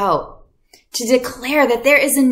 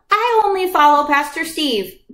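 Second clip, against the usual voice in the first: higher, proud little boast; kid claiming a favorite; 8 semitones higher than usual. Retold or imitated. Kid at church boasting about his pastor, mock proud voice. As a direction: imitate a kid picking sides. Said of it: "some of you guys started saying".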